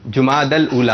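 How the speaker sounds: "جماد الاولا" as jumaadal-oola; short u after jeem in "جماد الاولا", then -dal; correct pronunciation. Shown as a demonstration. Host explaining pesh on jeem, "جماد الاولا" pronounced jumaadal-oola. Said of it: "جیم پر پیش ہے".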